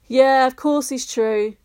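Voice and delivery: a smiling, slow reassurance. A narrator's reading. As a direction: reassure him with a smile. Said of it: "his grandmother smiled".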